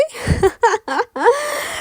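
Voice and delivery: high, strange disbelieving laugh; a short shocked laugh of disbelief; incredulous laugh. Acted. Rimas laughing at the captor's demand, high incredulous laugh.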